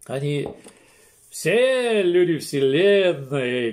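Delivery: ordinary talk, nothing marked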